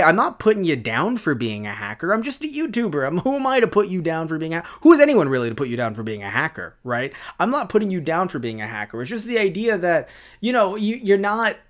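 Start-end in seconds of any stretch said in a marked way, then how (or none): none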